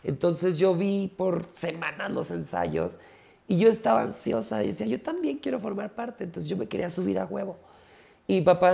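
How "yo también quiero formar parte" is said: put-on high little-girl voice; high-pitched eager little girl; childish wish. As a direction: plead like a small girl. Child wanting to join.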